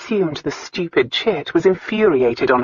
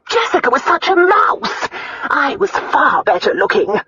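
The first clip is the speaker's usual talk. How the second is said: high, loud, fretful Countess grumbling; self-pitying aunt, the Countess; 8 semitones higher, 4 dB louder than usual. A narrator's reading. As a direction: grumble as a jealous, demanding aunt.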